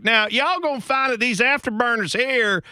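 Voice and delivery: high, exaggerated Southern twang; hammed-up Southern explainer; Southern accent. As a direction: play a folksy Southern rocket man. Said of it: "that accent has been used".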